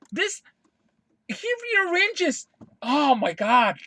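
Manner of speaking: high-pitched, incredulous sputter; real worked-up exasperation. Real, not acted. Fan too worked up to finish the complaint, sputtering. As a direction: voice shoots up in exasperation. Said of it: "I'm getting worked up".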